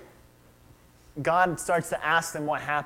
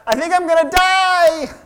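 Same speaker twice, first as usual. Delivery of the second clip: high, loud panicked wail; frightened tearful outburst; fearful crying cry; 13 semitones higher, 9 dB louder than usual. Acted. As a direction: cry it out in terror. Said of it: "she's crying, and she's overcome with fear".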